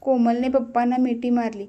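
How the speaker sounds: plain reading voice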